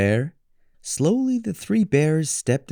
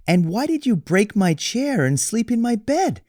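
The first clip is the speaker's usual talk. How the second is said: little bear; 12 semitones higher than usual. Acted. Little Bear presses Goldilocks, high cub voice.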